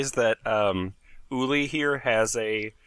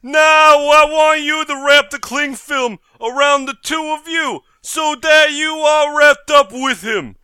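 bank robber; 10 semitones higher, 10 dB louder, slower than usual. Acted. Robber commands.

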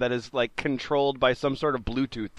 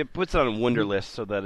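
ordinary talk

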